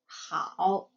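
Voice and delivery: 好 pitched low in a dipping tone; third tone. Shown as a demonstration. Teacher models 好 for learners, in third tone. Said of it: "and also a third tone".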